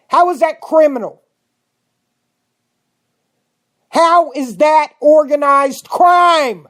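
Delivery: loud, high furious rant, words hammered out; furious and incredulous, slamming each question; angry. Real, not acted. Radio host berating listeners over the arrests, furious shout. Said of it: "I'm so angry".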